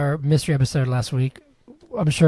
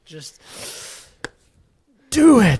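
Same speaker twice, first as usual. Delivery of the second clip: higher, louder hammed-up yell on do it; Shia LaBeouf shout; 6 semitones higher, 5 dB louder, slower than usual. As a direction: explode into do it like a mock coach. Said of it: "Shia LaBeouf?".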